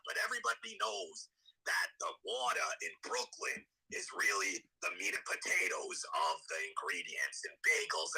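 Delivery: quiet, hammed-up New York impression; put-on thick New York voice; thick New York accent. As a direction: ask it like a Brooklyn food snob. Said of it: "thick New York accent".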